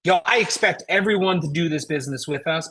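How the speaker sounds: usual speaking voice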